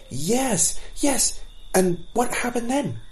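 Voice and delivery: put-on high, eager young fawn calling out; high excited child's question. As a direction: burst in like an impatient youngster. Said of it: "shouted an eager fawn".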